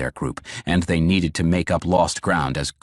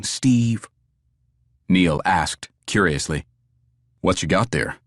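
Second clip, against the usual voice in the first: stern country woman; 7 semitones higher, 4 dB louder than usual. A narrator's reading. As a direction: scold him flatly, stone-faced. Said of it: "stone-cold visage".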